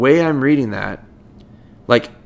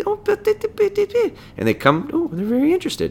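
enticing high tone; 3 dB quieter than usual. Shown as a demonstration. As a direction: coax the dog over, sing-song and high. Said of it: "I'll change my tone and be very".

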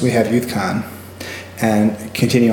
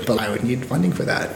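laughing whispered aside; whispering; 6 dB quieter than usual. Real, not acted. Conspiratorial aside about bail funding.

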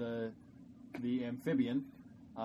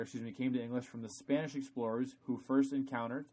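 normal talking voice

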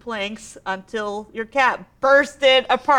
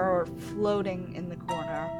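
usual speaking voice

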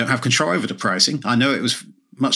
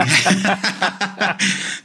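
laugh; 9 semitones higher than usual. Real, not acted. Laughs at own joke.

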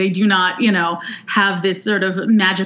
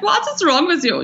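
higher-pitched fake Swedish accent, coming out rather German; badly faked Swedish accent, pitched up; mock Swedish accent; 8 semitones higher than usual. Imagined Swedish listener scolding the hosts, in a mock Swedish accent. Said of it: "some Swedish person would be like".

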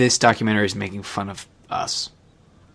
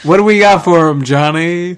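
laughing call out; 7 semitones higher, 7 dB louder than usual. Real, not acted.

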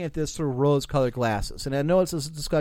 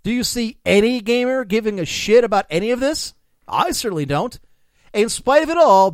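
louder, rising smug tirade; escalating comedy rant; 6 semitones higher, 5 dB louder than usual. Acted. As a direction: build the gloating rant. Fake quote builds to climax.

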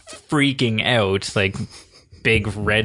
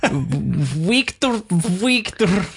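high mock Russian accent, Chekov style; 8 semitones higher, much slower than usual. Fan quoting the reboot bridge scene in a hammed-up Russian accent. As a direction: exaggerate the Russian accent on Victor. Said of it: "that Russian accent".

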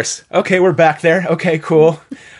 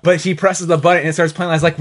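ordinary talk

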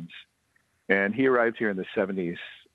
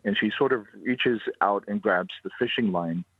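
usual speaking voice

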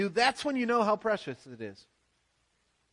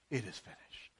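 dying breath; 10 semitones lower, 14 dB quieter than usual. Retold or imitated. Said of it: "last dying breath".

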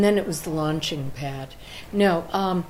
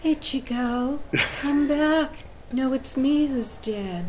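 anime mom voice; 6 semitones higher, slower than usual. Acted. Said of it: "What's it like?".

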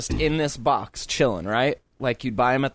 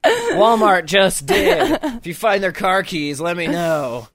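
mock rant; 6 semitones higher, 4 dB louder than usual. Co-host acts out her furious return.

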